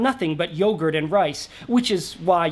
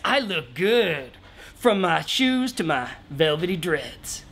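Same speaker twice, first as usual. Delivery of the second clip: easygoing, grooving hippie voice, higher in spots; mellow hippie shaman; 4 semitones higher than usual. Acted. Shaman telling the king to relax and dress wild, mellow. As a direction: sell the wild clothes like a chilled-out guru.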